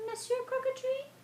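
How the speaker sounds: high, quick squeaky reply; high little boy voice. Acted. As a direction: chirp it like a small child. Gingerbread boy's reply.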